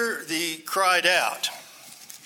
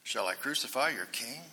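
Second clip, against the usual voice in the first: a soft, quiet asking, much softer; Pilate's quiet question; 3 semitones lower, 8 dB quieter than usual. Retold or imitated. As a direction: let it drop to a hushed question. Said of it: "Pilate said to them".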